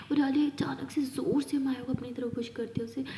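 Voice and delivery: plain reading voice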